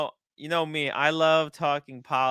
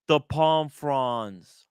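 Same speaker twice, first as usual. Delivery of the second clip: ordinary delivery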